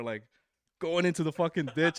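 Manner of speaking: ordinary talk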